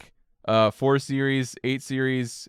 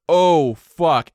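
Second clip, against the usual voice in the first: retold thought; 3 semitones higher, 7 dB louder than usual. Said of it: "my thought was".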